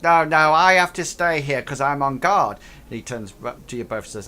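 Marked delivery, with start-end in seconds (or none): 0.0-2.6 goblin on guard. Acted. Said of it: "turns around and says".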